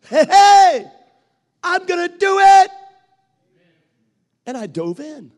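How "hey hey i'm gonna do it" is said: much higher and much louder, all in; fired-up shout. Retold or imitated. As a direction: yell it like you just decided to jump in. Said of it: "because I thought".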